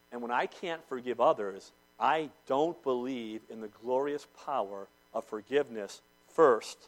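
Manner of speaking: ordinary delivery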